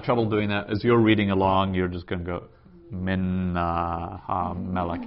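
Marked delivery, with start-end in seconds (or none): 2.9-5.1 halting, "Min", a hesitation, then "HaMelech"; a learner piecing the word together; sounding it out. Shown as a demonstration. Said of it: "you're gonna sound it out".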